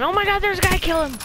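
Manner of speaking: high, loud urgent yell; excited shout. Real, not acted. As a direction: shout at the screen. Spots an enemy mid-game.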